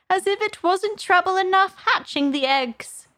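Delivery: high, put-on bird voice, complaining; aggrieved pigeon. A narrator's reading. Pigeon troubled by hatching eggs, aggrieved. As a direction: bewail your lot in a high voice. Pigeon lamenting its hard lot.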